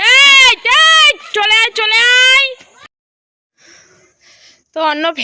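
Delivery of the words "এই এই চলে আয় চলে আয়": loud drawn-out call, high. Real, not acted. Calling child to come out.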